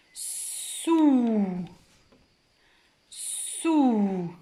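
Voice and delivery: "sou" as a long "sssouuu"; blended syllable. Shown as a demonstration. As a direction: hiss at length, then open "sou" into a held vowel. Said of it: "je vais lire". (« sou » avec S sifflé, voyelle allongée)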